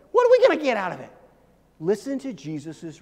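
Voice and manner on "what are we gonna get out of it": blunt Peter question. Retold or imitated. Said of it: "he basically just says".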